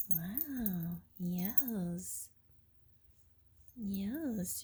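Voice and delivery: ordinary talk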